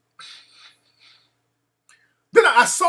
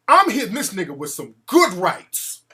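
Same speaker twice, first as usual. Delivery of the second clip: ordinary delivery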